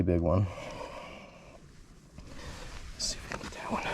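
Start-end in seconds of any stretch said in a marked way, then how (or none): 2.9-3.8 barely voiced hush; whispering. Real, not acted. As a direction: whisper it under your breath. Watching fish on graph.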